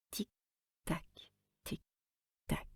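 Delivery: soft ticking, almost breathed; ticking clock. Acted. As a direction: tick it out softly like a big clock. Imitation de l'horloge. (tic-tac soufflé comme une horloge)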